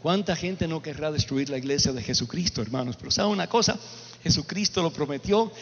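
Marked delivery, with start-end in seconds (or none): none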